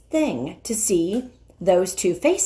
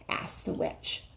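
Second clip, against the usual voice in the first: plain reading voice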